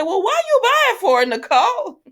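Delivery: very high, loud hammed-up outburst; mock exasperated yell. Viewer heckling Nicole over the too-tight dress, mock exasperated shout. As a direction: holler it at the character in comic disbelief.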